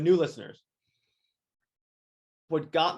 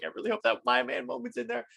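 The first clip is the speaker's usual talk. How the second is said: hopeful excitement; 11 semitones higher, faster than usual. Retold or imitated. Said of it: "we're like".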